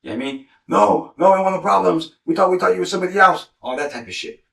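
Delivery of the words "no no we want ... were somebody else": high, louder frightened denial; mock terrified men at gunpoint; scared pleading.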